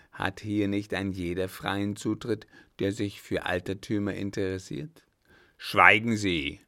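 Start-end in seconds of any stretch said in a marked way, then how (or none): 5.6-6.6 loud, slow command to be silent; thundering. A narrator's reading. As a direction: thunder it at him, drawn out. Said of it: "donnerte ihm da der Alte entgegen". (donnernd, laut und gedehnt)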